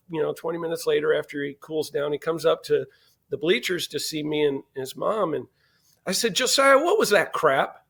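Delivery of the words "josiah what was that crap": mock scold. Dad scolding son.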